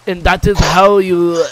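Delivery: slow, loud mock Japanese accent. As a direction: ham up a Japanese accent, slowly. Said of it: "Why did you sound Japanese there".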